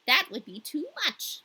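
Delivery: a hint of the wise old woman; lightly voiced Finland woman. A narrator's reading. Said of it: "same voice as the Lapland woman".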